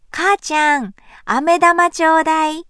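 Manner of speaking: put-on a small child begging sweetly. A narrator's reading. Child asks for candy.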